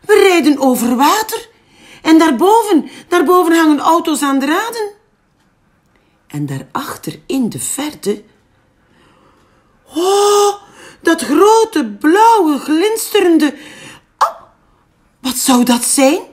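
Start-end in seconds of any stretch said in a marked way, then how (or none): 0.0-4.9 high and loud, amazed; excited calling. Acted. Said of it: "riep Kikker". 9.9-16.2 high, loud, breathless wonder; amazed wonder. Acted. Frog first seeing the sea.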